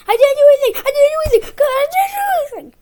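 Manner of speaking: high, loud, shrieked denial; mock bratty yell. Boy mimics Jack yelling his denial, high and loud. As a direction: scream it like a spoiled kid.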